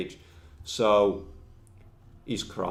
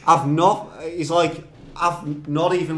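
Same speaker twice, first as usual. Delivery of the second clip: mock exasperation; 7 semitones higher, 8 dB louder than usual. Acted angry outburst in story.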